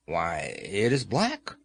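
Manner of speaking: in wonder. A narrator's reading. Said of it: "in a tone of wonder".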